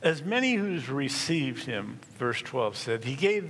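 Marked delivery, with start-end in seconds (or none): none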